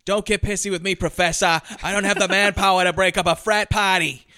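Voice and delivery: loud mock Boston accent; gnarly Boston accent. Cop telling off the professor, exaggerated Boston accent. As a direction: lay on a thick, hammed-up Boston accent. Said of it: "gnarly Boston accent".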